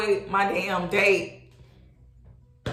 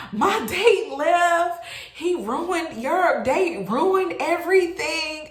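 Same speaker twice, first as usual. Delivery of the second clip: high, loud, stretched-out mock wail; dramatic, drawn-out blaming; upset friend's accusation; 8 semitones higher, 4 dB louder, slower than usual. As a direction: imitate a friend whining in outrage.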